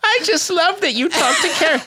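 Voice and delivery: put-on high, laughing old lady voice; giggly high granny fan. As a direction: squeak it out like a very old woman. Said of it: "Her name's Enid".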